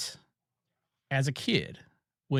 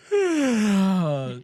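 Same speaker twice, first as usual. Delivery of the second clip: long drawn-out yawn, louder and higher; big yawn; 5 semitones higher, 6 dB louder than usual. Pretend. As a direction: act out a huge sleepy yawn. Tells of yawning in the car.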